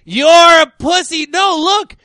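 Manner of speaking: high, loud jeer; mock taunting. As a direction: play a pushy gun owner scoffing. Acting out a taunting gun owner.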